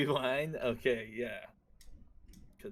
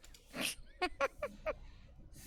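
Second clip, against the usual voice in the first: squeaky unvoiced laugh; high breathy giggle; 5 dB quieter than usual. Real, not acted. Laughs after the lisp bit.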